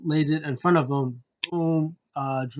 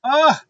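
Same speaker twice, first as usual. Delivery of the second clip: loud, high outburst; real high-pitched cry of feeling; emotional groan; 11 semitones higher, 8 dB louder than usual. Real, not acted. Reviewer overcome by the story, loud high groan.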